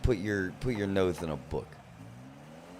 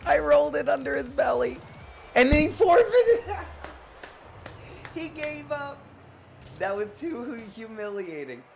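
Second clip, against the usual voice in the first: mock gloating kid; 8 dB louder than usual. Gloating at the forfeiting rival in a squeaky kid voice. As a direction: crow like a smug little kid.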